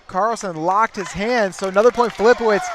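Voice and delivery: normal talking voice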